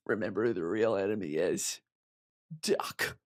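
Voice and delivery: put-on film hero, low and intense; Finnick. Streamer doing a Hunger Games impression, low then punchy. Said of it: "that was my Finnick impression from Hunger Games".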